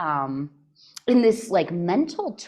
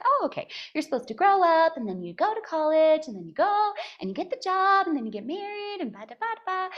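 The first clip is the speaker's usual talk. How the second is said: higher, singsong rattling off of the formula; mock cheerful recital; 8 semitones higher, faster than usual. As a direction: rattle it off high like a mocking checklist. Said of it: "a formula for living where it's like".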